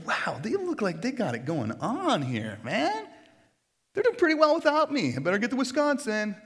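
high, sing-song marvelling; hammed-up impressed onlooker; mock amazement. Acts out onlooker admiring rich streets.

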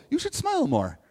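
high, put-on patronizing voice; mock condescending guy. Preacher mocks men who tell women to smile, high put-on voice. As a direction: sneer it like a smug guy telling a woman to smile. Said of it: "things to never say to a woman".

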